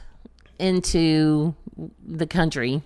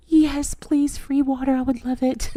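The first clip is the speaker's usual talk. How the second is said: eager yes; 8 semitones higher than usual. Retold or imitated. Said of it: "And I'm like".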